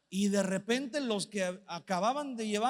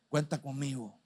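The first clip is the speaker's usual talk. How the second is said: mucho más grave, pausado; promesa de borracho; 5 semitones lower than usual. Retold or imitated. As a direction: imita al borracho que promete todo. (el borracho promete apoyo, voz grave y pausada)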